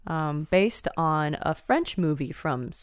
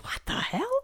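much higher, imitating her own shocked reaction; a retold gasp of amazement at the talent; stunned disbelief; 6 dB quieter than usual. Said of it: "and I was like".

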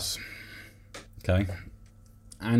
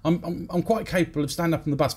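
higher-pitched huffy tirade as the dwarf; indignant dwarf rant; 7 semitones higher than usual. Retold or imitated. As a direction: keep ranting, offended and high. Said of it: "this dwarf goes off on one".

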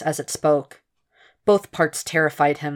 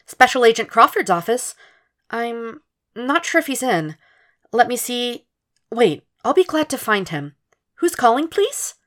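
much higher, polite office receptionist; 7 semitones higher than usual. Acted. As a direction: sing it out like a cheerful switchboard secretary. Narrator voices receptionist Janet.

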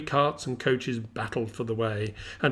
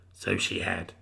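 put-on a much lower, softer old man; low, quiet old miser; Scrooge voice; 5 dB quieter than usual. A narrator's reading. Scrooge answers the ghost.

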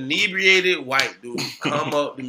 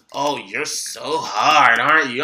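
slow drawling mock taunt; imitating a drunk guy's sneer; 4 semitones lower, slower than usual. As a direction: stretch it out smugly.

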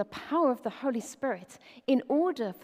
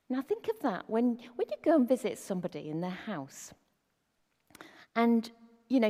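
ordinary delivery

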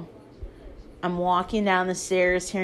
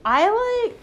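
high squeak; 14 semitones higher, 4 dB louder than usual. Retold or imitated. Story peak, high squeak.

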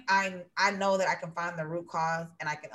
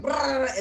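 raised, rolled "brrr" noise; imitated angry outburst; 5 semitones higher than usual. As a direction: mimic someone going off in one burst of noise. Imitates people going off.

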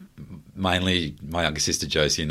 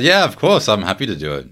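eager; 5 semitones higher, 6 dB louder than usual. Retold or imitated. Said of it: "I just said".

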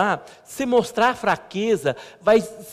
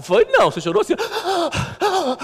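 much higher voice mimicking his wife; mock her teasing, pitched up; 13 semitones higher, slower than usual. Pastor imitating his wife teasing that he cried, high mock voice. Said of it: "Daí ela falou".

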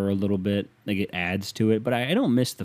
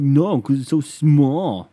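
loud, slow, higher mock whine; whining non-fan; 4 semitones higher, 6 dB louder, much slower than usual. Mocking people who can't follow the puck, whiny. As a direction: drag it out like a dim complainer. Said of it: "And they're like".

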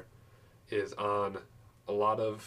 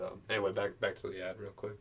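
ordinary delivery

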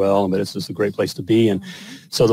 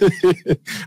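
a burst of real laughter, higher; 6 semitones higher than usual. Real, not acted. Husband laughing at his own libido quip, real laughter.

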